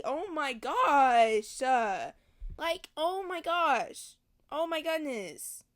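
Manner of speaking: loud, slow, drawn-out oh my gosh; stretched loud exasperation at the book; exasperated outburst. Real, not acted. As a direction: groan it out loud in disbelief.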